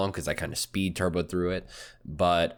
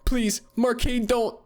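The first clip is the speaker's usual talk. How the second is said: voice breaking; 11 semitones higher than usual. Acted. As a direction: beg them to stay, voice cracking. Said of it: "Their voice breaking, filled with the immense desperation".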